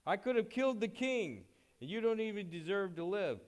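high, loud shouted accusation; retold yell. Abner yelling down at David from the cliff, loud and accusing. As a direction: yell it down from the cliff like a furious commander. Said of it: "he yelled down there and he said".

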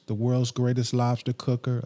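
normal talking voice

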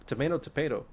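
ordinary talk

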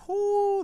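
high, loud falsetto whoo; a big celebratory whoop; excited whoop. Real, not acted. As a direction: cheer out with a shrill whoo. Celebrating the people uniting.

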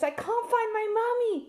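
put-on high small child's voice, on the edge of tears; little lost girl. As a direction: speak as a scared four-year-old who lost her mum. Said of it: "And she says".

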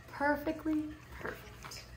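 plain reading voice

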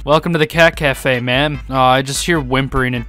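ordinary delivery

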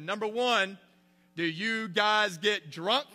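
hammed-up boy preacher drawing it out; mock preacher. Seventh grader preaching at his homeroom class, mock sermon.

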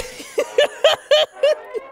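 high rueful laugh. Real, not acted. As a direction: laugh it off in disbelief. After realising the scam.

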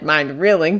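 ordinary delivery, nothing marked

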